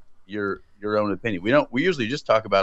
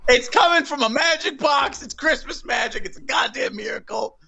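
mock excited. Mock magic explanation to cohost.

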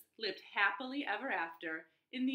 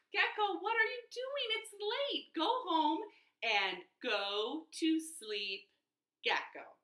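weary elephant; 7 semitones higher than usual. Acted. Tired elephant scolding gecko.

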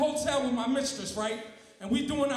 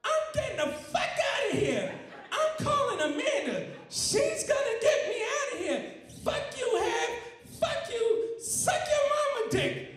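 high, sing-song put-on voice ordering food; Willie voice; 10 semitones higher than usual. Comedian voicing Willie ordering McDonald's at work. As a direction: pitch way up as the childlike coworker. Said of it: "Willie's high voice again".

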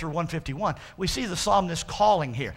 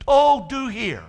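stressed, louder "Oh, do hear"; emphatic plea; 3 semitones higher, 5 dB louder, slower than usual. Acted. Preacher explaining the word to church, emphatic plea. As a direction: lean hard on OH, DO HEAR. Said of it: "notice how that word "hear" there means".

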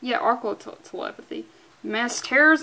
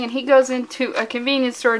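ordinary talk

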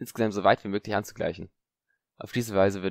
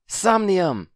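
sharp high spell cry, much louder; loud incantation; shouted spell; 11 semitones higher, 7 dB louder than usual. Acted. Sleep spell cast in duel.

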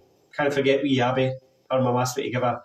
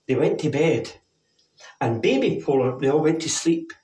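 ordinary delivery

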